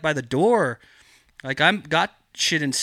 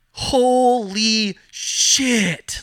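high, very drawn-out holy shit, louder; stunned awe; 7 semitones higher, 5 dB louder, much slower than usual. Retold or imitated. Grower amazed at his own pristine plants, drawn-out awed exclaim. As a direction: let it stretch out in disbelief.

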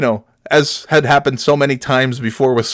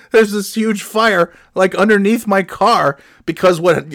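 normal talking voice

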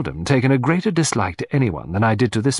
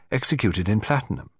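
plain reading voice

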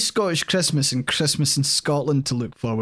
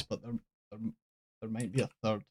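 dropping almost to a murmur, reluctant to break the news; reluctant hush; 5 semitones lower, 13 dB quieter, faster than usual. Real, not acted. Admitting to Joy there's a third film, hushed and reluctant. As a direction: say it under your breath like bad news. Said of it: "Joy, I absolutely hate to say this".

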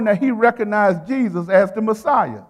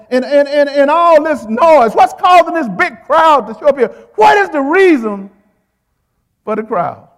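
voiced blind beggar; 3 semitones higher, 5 dB louder than usual.

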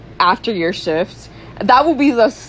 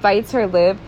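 ordinary delivery

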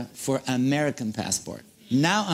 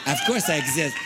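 higher, laughing protest; laughing insistence; 9 semitones higher than usual. Retold or imitated. Insisting to the clerk that he exists, laughing. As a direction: laugh it off in disbelief.

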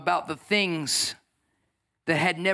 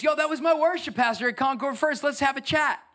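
put-on eager kid; 7 semitones higher than usual.

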